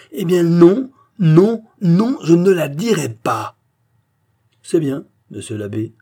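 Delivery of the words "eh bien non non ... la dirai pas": loud, high, emphatic string of refusals; defiant refusal. A narrator's reading. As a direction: refuse outright, voice raised high. Abbé defiantly refuses the mayor. (refus véhément, voix forte et haute)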